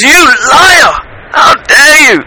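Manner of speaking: high, acted accusing shout; outraged shout. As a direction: confront the cheat in indignation. Woodcutter confronts Iblis, fight follows.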